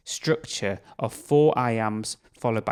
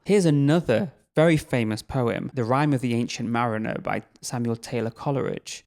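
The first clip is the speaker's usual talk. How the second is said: ordinary talk, nothing marked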